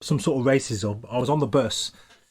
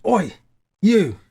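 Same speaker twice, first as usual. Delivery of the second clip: sharp call of Oi, you, pitched up and louder; 7 semitones higher, 6 dB louder than usual. Retold or imitated. Policeman stopping a kid on the street, calling out loudly. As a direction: policeman hailing a boy across the street.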